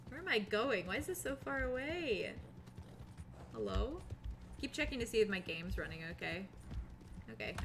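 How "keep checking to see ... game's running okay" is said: ordinary talk, nothing marked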